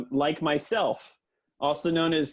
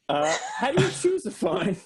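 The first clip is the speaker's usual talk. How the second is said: laughing; 7 semitones higher than usual. Real, not acted. Presenting absurd slides, laughing.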